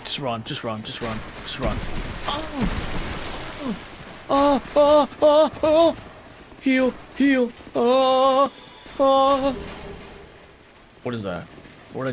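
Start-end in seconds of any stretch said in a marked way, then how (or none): 2.3-6.0 repeated high-pitched yelps; string of high cries of alarm; panicked yelps. Real, not acted. Gamer under attack in game, high panicked yelps. 6.6-7.5 high, urgent repeated call; urgent cry. Real, not acted. Gamer under attack needing health, high urgent cry. 7.8-9.6 high, loud drawn cries; panicked yelps. Real, not acted. Gamer under attack in game, high panicked cries.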